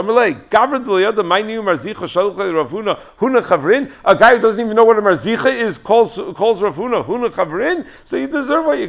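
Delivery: loud, high, scornful scolding; indignant rebuke. Acted. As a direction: tear into him for his arrogance. Marukva rebukes Rav Anan.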